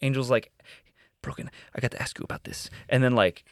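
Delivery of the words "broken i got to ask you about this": very soft, near-whispered imitation; lightly acted hushed question; hushed confidential quote. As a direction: drop right down as if quoting someone confiding. Retells friend's confidential question.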